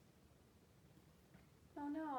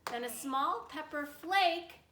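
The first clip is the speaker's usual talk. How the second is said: rhyming story narration; 5 dB louder, much slower than usual. A narrator's reading. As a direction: lift the rhyme toward the bear's lair.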